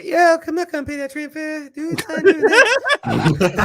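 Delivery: squeaky mocking impression, drawn out at the end; high-pitched mimicry. Mimicking the co-host refusing a train fare, squeaky mockery. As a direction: put on a high, silly voice to tease. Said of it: "That's how you sound".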